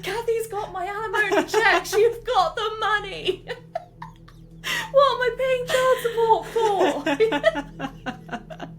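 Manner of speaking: a hammed-up ex-husband complaining; laughing mock rant about alimony; mock dad rant. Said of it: "dad's rant".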